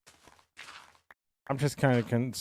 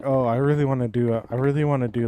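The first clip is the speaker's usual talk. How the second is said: louder, higher quoted excitement; retold eagerness; 4 semitones higher, 7 dB louder, faster than usual. Streamer recalling wanting a zoo, mock-eager and louder. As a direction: act out your own eager idea, louder. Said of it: "I was like".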